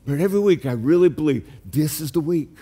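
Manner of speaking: ordinary delivery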